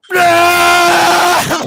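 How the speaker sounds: long, loud, high imitated roar; mock movie roar. Mocking the actor's unconvincing roar, mock movie roar. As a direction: hold a hammed-up yell on one note. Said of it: "he looks like, he's like".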